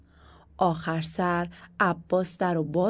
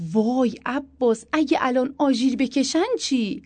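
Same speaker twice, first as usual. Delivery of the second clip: frightened; 7 semitones higher, 6 dB louder than usual. Acted. Wife fearfully asking her husband about the siren, high and loud. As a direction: cry out in alarm at the siren. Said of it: "با ترس گفتم".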